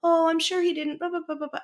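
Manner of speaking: high-pitched retold excuse-making; imitating child's excuses. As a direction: mimic a kid making excuses, trailing into blahs. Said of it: "making excuses for this kid".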